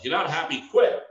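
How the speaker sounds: high, loud imitation of online pep talk; mock exhortation. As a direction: blurt it out like an online motivator. Mimics online motivators urging quitting.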